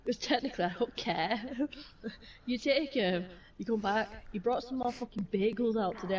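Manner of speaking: ordinary talk, nothing marked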